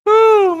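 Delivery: loud, high-pitched whew; real winded whooping exhale. Real, not acted. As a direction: let out a big high whoop of relief after laughing. Catching breath after laughing.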